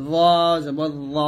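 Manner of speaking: "জ" said heavy, with a rounded o vowel; thick articulation. Shown as a demonstration. Teacher contrasting it with thin letters, thick articulation. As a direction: make "জ" full and thick, never aa. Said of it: "জটা মোটা হবে".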